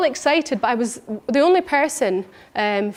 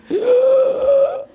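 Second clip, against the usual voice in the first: sharp gasp; 12 semitones higher, 4 dB louder than usual. Retold or imitated. Shock at own reflection.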